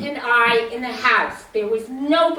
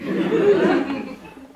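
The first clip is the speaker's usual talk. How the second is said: quick chuckle; short laugh; 4 semitones higher, 5 dB louder than usual. Real, not acted. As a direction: chuckle briefly. Laughs at own story.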